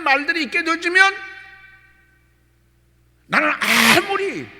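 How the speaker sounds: loud, high, fervent sermon; preaching at full force. Acted. Pastor exhorting his congregation, loud and high. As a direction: exhort the congregation to examine themselves.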